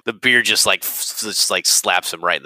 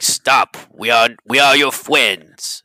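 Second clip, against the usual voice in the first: a bit louder, slow half-hearted command; trying to be commanding; 5 semitones higher, 5 dB louder, slower than usual. Acted. Howald ordering the Mind Flayer to stop, mild commanding tone. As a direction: try to sound in charge but dazed. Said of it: "he's trying to be commanding".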